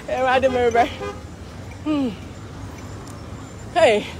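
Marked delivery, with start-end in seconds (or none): none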